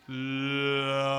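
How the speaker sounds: "Largo" drawn out long and slow, vowels stretched; said very slowly. Shown as a demonstration. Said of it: "Let's see how slow we can say largo".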